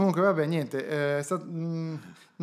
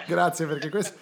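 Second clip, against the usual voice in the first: ordinary talk, nothing marked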